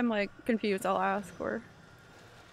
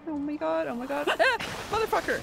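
laughing yelp into a loud curse; real fright burst out loud; startled scream; 6 semitones higher, 4 dB louder than usual. Real, not acted. Gamer startled by a pot, screaming and cursing. Jump scare in game.

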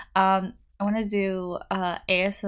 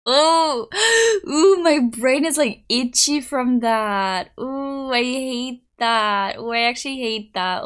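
higher, drawn-out grossed-out groaning; squirming disgust; 3 semitones higher, 7 dB louder, slower than usual. Real, not acted. Reacting to baked beans filling a brain, squirming disgust. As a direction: recoil from it, stretching the words out.

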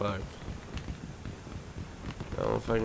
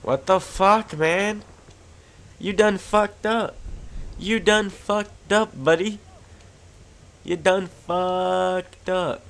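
high, loud, slow threat; loud taunting; 8 semitones higher, 4 dB louder, slower than usual. Real, not acted. Player taunting the enemy who killed him, loud and slow. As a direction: draw out each taunt at your enemy.

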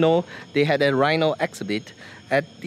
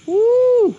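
high joyful whoop; excited whoop; 6 dB louder than usual. Real, not acted. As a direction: let out a delighted woo. Cheering at the rhino.

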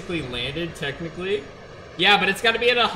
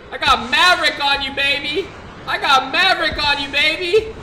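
much higher, louder cocky yell; hype taunt; 9 semitones higher, 7 dB louder than usual. Real, not acted. Gamer taunting the enemy pilot, cocky loud yell. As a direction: gloat like a hotshot fighter pilot.

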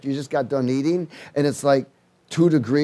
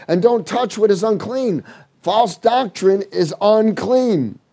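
impassioned scripture reading; preaching; 3 semitones higher than usual. Acted. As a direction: preach the verses with fire.

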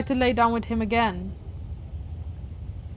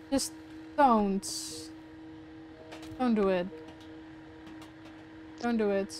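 quiet pleading; 3 semitones lower, 11 dB quieter, slower than usual. Real, not acted. Player pleads softly for friend's life.

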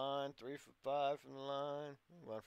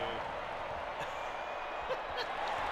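breathy disbelieving laugh; 2 semitones lower, 5 dB louder than usual. Real, not acted. Disbelief at opponent's reach.

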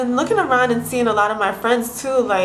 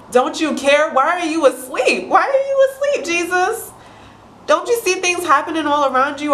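climbing higher and higher in mock panic, peaking on asleep; hammed-up alarm, rising to a near squeal; panicked disciples pleading; 5 semitones higher than usual. Disciples waking Jesus in the storm, mock panicked pleading. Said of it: "They're like".

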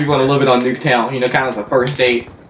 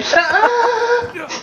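mock girl voice. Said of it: "She's like".

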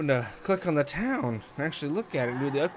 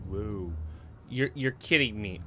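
ordinary talk, nothing marked

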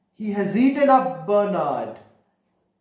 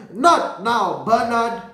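higher, louder scolding from an annoyed mum; angry mother; 6 semitones higher, 5 dB louder than usual. A narrator's reading. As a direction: brush the child off crossly, raised voice. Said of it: "the mom gets really angry".

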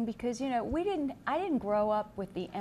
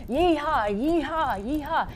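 higher, louder cowboy whoops; cowboy yeehaw; 5 semitones higher, 4 dB louder, slower than usual. Pretend. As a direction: whoop like a cowboy galloping west. Cowboy galloping out west.

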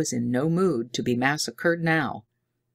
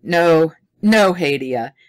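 firm reassurance; 9 dB louder, slower than usual. A narrator's reading. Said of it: "Dodd explained".

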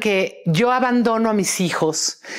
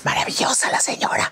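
whisper; faster than usual. Real, not acted. Admiring aside.

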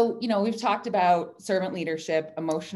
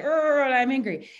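"Urgh" groaned higher and louder, then "I'm angry"; mock-exasperated, higher and louder; 7 semitones higher, 5 dB louder, slower than usual. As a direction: vent the frustration with a groan. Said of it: "or I'll be like".

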